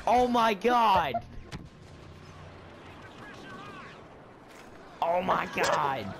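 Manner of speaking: drawn-out, slow oh my God; slow disbelief. Real, not acted. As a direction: stretch it out in disbelief. Disbelief at rock death.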